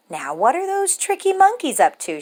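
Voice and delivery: a hint of a puzzled girl; wondering child. A narrator's reading. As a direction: wonder aloud like a curious little girl. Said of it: "she wondered".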